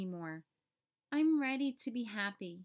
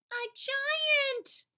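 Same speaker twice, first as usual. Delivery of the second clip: high, startled gasp of a line; alarmed cry; 4 dB louder than usual. Acted. Seed startled by a giant, high alarmed cry. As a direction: squeak it out in shock.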